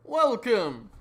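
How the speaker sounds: usual speaking voice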